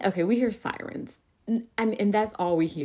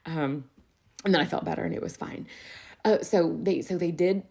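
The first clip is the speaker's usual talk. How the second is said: ordinary talk, nothing marked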